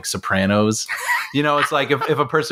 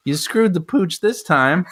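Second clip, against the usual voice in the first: slow, higher, laughing fake British accent; laughing mock British accent; 8 semitones higher, slower than usual. Host parodies Costner scolding Pratt, hammed British accent. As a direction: ham up a bad British accent through laughter. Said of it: "I'll do my British accent".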